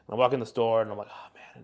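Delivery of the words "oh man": whispered. Retold or imitated. Retold muttering in the store.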